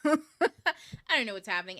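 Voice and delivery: loud, slow, laughing through the words. Real, not acted. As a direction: crack up at your own catchphrase.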